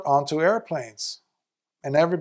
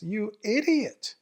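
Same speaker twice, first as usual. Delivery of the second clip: higher-pitched scolding, rising to sharp peaks; 6 semitones higher than usual. Retold or imitated. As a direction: scold a foolish monk in disbelief. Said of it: "Lord Buddha scolds him".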